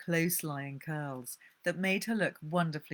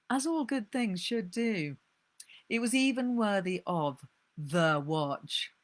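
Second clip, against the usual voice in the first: plain reading voice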